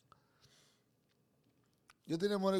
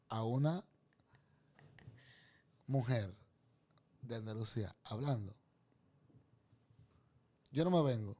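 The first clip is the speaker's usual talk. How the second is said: usual speaking voice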